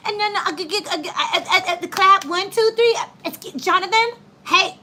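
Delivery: high, stammering mock teacher; flustered teachers. Imitating teachers failing to settle a class, flustered.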